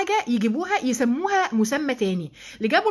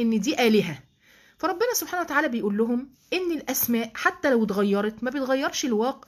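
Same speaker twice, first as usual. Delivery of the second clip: ordinary delivery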